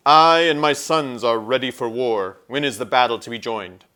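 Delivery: loud, proud centaur voice; a warrior prophet ready for battle; noble centaur. A narrator's reading. Centaur pledging his sons to the king, loud and proud. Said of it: "He spoke in such a voice".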